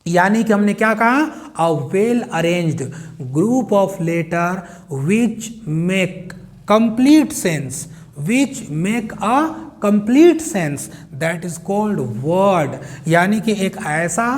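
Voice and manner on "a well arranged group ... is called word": very slow, word by word, for notes; spelling out a definition slowly for the class; slow dictation. Shown as a demonstration. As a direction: dictate it so students can write.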